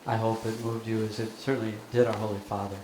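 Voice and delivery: usual speaking voice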